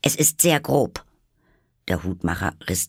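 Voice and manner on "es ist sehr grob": higher-pitched, mildly severe rebuke; stern. A narrator's reading. Alice rebukes the rude Hatter, stern. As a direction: tell him off primly. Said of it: "mit einer gewissen Strenge".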